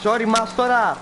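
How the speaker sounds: loud, high shout to another driver; called-out apology. Pretend. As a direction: holler sorry from behind the wheel. Calls apology out while driving.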